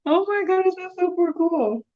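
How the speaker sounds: ordinary talk, nothing marked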